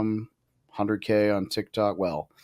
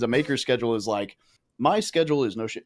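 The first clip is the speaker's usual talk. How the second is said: ordinary talk, nothing marked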